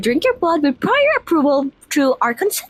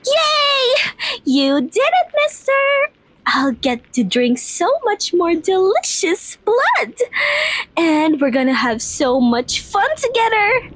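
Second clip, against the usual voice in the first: excited; 7 semitones higher than usual. Acted. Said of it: "I'm so excited!".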